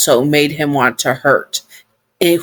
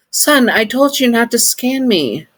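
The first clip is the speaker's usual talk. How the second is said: voiced listener; 8 semitones higher than usual.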